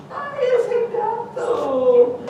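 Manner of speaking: high, slow, crying wail; little sister crying out after the scare. Acted. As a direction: wail it out like a shaken little girl. Sister wails relieved.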